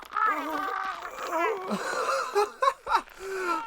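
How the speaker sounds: high gloating villain laugh; evil cackle. Acted. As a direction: cackle at their choking.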